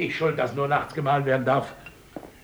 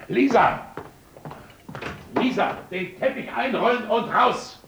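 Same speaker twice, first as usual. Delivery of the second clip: calling out; 11 semitones higher than usual. Acted. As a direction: bark the order up the stairs. Calling orders upstairs.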